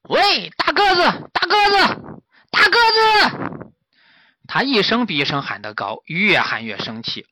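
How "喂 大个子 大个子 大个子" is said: high, loud, slow calls building; yelling up at a deaf giant; high drawn-out shouts building up; shouting up. A narrator's reading. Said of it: "朝他高声喊".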